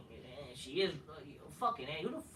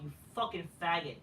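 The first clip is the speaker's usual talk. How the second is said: ordinary talk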